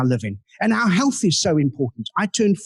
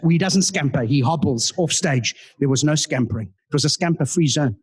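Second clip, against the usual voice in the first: normal talking voice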